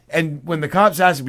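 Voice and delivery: ordinary talk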